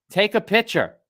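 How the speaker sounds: put-on high, loud little-girl voice; piping kid insisting on a photo; daughter's demand. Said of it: "She says".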